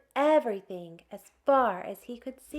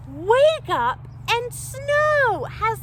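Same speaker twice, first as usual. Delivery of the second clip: gasp, then a louder, higher "wake up"; excited wake-up; 13 semitones higher, 7 dB louder, slower than usual. Acted. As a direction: burst out with delight at the snow. Acting out waking to snow.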